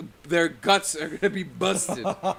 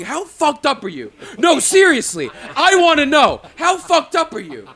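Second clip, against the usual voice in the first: loud, high furious interrogation; angry boss quote; 9 semitones higher, 8 dB louder than usual. Retold or imitated. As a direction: demand an answer, getting angrier. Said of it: "remember Anthony going".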